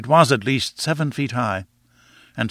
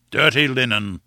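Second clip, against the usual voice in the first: usual speaking voice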